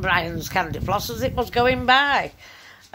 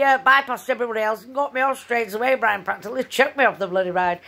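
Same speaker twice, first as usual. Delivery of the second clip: usual speaking voice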